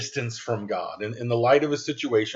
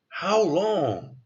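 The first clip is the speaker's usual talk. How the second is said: pleading cry; 6 semitones higher than usual. Acted. Repeats the psalm's plea.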